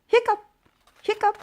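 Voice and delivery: high squeaky hiccup noises; monster hiccups. Acted. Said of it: "the monster had hiccups".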